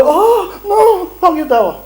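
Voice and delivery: high, laughing mock panic; mock fear. Preacher mocking his own fear, hammed-up fearful cry. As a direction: ham up being scared to give dawah. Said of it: "I wanna go".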